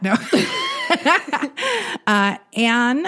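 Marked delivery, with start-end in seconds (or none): 0.2-2.0 high-pitched laugh. Real, not acted. Laughs at Missus Hamster.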